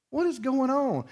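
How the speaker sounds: high, startled what is going on; alarmed question. Retold or imitated. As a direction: burst in wanting answers. Walking in on argument.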